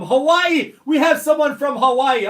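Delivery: shouting the news to the stream; excited shout. Real, not acted. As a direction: call it out delighted. Excited at a viewer from Hawaii.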